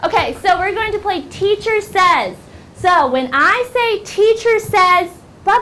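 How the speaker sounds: put-on high, loud teacher talking to young kids; upbeat classroom teacher. Shown as a demonstration. Teacher opening a game with the class, high and upbeat. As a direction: sing out to a class, high and bright.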